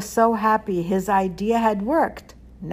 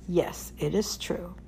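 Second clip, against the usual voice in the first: soft breathy whisper of a confession; whispered admission; 6 dB quieter than usual. A narrator's reading. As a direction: whisper it, barely voiced. Zusha admits quietly to rabbi.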